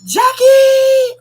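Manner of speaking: very high, loud delighted call; excited shout. Real, not acted. Streamer spotting a favourite viewer, shouting her name.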